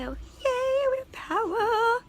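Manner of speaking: very high, squealing with joy; excited squeal. Real, not acted. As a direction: squeal the good news. Power back on in trailer.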